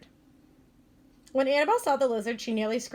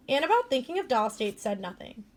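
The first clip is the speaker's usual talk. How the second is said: ordinary delivery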